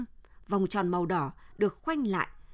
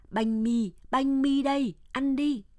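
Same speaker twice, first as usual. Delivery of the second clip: lightly voiced foreign soldier, louder and higher; soldier offering bread; 6 semitones higher, 4 dB louder than usual. A narrator's reading. Soldier handing a village child some bread, lightly called out. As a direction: call it out lightly, raised and drawn level.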